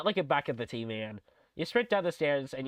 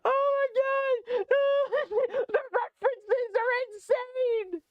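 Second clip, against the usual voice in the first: high, slow excited shriek; thrilled shout; 3 dB louder, slower than usual. Real, not acted. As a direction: scream with delight at the screen. Thrilled reaction to game.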